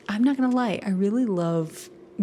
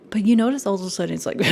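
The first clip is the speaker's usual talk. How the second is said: ordinary talk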